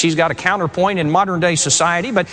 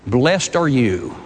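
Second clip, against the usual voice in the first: gentle blessing; 7 semitones lower, much slower than usual. Retold or imitated. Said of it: "and he said".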